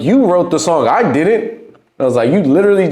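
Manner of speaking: high mock complaint; mock argument. Rapper blaming the engineer for the song, high and mocking. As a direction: push back, lightly hammed up.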